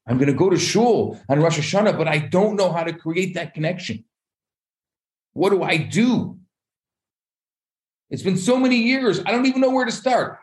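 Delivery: high-pitched, climbing fretting; acted helplessness. Soul unsure how to talk to God, acted helplessness. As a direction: plead at a loss, voice rising high.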